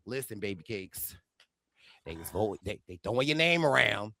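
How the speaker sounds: very low, hushed confiding voice; Cirie impression. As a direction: imitate a cool schemer, deep and soft. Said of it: "Cirie like".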